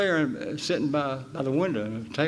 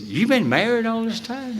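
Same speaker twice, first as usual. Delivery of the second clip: laughing disbelief; 12 semitones higher than usual. Retold or imitated. Pal mocks his marriage.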